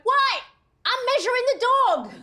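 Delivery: high, imitated Staten Island father; indignant dad in a Staten Island accent; Italian-American dad. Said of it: "my dad just went".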